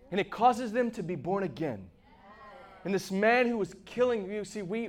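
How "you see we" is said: normal talking voice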